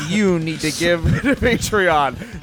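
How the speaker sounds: slow, regal proclamation; slow grandiose voice. Acted. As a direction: announce it slowly like a king. Grand slow mock plug.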